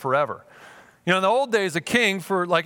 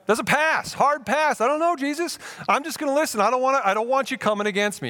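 reluctant refusal; 8 semitones higher than usual. Acted. Acts out avoiding Jesus's confrontation.

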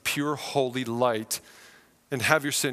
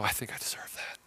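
whispered, much softer, defeated admission; ashamed whisper; 7 semitones lower, 11 dB quieter than usual. Retold or imitated. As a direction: breathe it out like a guilty confession. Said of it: "you're gonna go".

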